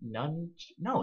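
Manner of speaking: usual speaking voice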